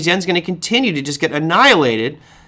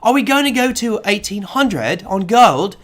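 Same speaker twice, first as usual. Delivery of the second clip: slow, imitated Australian accent, pitched higher; 4 semitones higher than usual. TV interviewer putting a gold price to her guest, in an imitated Australian accent. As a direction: draw the question out in imitated Australian. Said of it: "has this lovely Australian accent".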